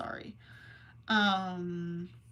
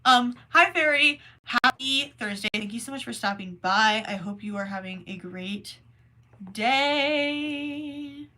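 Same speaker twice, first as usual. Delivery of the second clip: ordinary delivery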